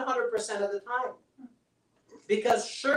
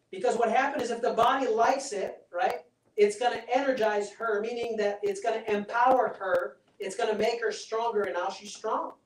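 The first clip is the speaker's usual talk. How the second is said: ordinary talk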